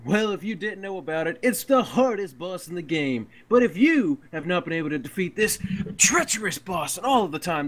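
upbeat sales pitch voice; ad read. Acted. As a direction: sell it like a radio advert. Reads a guild advert.

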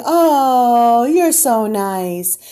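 gushing, drawn-out mocking coo; fake-nice sing-song, imitating a phony smiler; mock sing-song sweetness. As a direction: stretch it out in a loud, syrupy sing-song. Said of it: "sing-songing every word".

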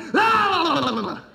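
loud, high yell; loud, high yell of a devil crying out; shouted cry. Retold or imitated. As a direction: let out a wild shouted cry.

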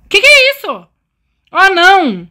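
high, loud startled cry; shocked by the sudden storm; startled alarm. Real, not acted.

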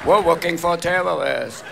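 slow, drawn-out cartoon hunter voice. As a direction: stretch it out like a stalking cartoon hunter. Elmer Fudd style stalking catchphrase.

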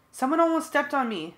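loud, high alarmed yell. A narrator's reading. Narrator yells a warning to friends, high and loud. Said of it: "I yelled to the others".

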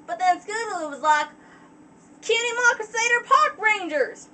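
put-on high, loud, excited country-filly accent; Apple Bloom accent. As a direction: exclaim it in Apple Bloom's twang. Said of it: "accent I can do for Apple Bloom".

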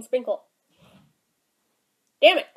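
teeth scraping cookie edge; speaking between small bites; while nibbling a cookie. Real, not acted. Seen 0: Raises cookie to teeth repeatedly, scraping off a sprinkle mid-phrase.

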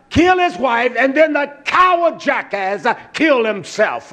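impassioned, driving sermon delivery; full-force preaching. Real, not acted. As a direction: preach it out with punched key words.

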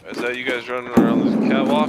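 loud call across the map; yelling across the room. Real, not acted. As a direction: yell the question loud.

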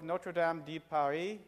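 slow, French pronunciation of Notre Dame; French accent. Shown as a demonstration. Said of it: "my French accent".

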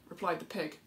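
usual speaking voice

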